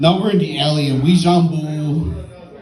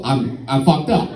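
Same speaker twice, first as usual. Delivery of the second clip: ordinary talk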